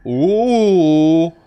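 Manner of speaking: usual speaking voice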